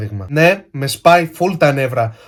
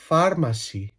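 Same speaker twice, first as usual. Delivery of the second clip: PHARMACY softer, with a rolled Greek r; mock Greek accent; 7 dB quieter than usual. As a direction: pronounce "Pharmacy" as a Greek would. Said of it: "με ελληνική προφορά".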